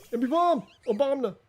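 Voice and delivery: high silly yell; meme shout. Pretend. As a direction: belt the meme name out. Meme shout at game character.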